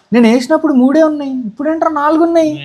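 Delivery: high-pitched, incredulous outburst; startled, rushing into the question; astonished. Real, not acted. Startled by an extra fish, after gasp.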